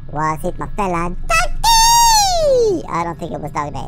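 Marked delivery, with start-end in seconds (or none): 1.3-2.9 very high, loud drawn-out squeal; playful squeal. Pretend. Player guesses the creature's name, playful squeal. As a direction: squeal it long and silly. Silly calling out at game creature.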